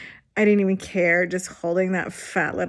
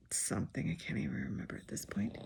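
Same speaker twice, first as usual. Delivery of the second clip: whispered aside; 10 semitones lower, 15 dB quieter, faster than usual. Real, not acted. Patient in hospital bed, murmuring an aside: quick whisper. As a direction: drop to a breathy whisper, fast. Trailing aside about forgotten food.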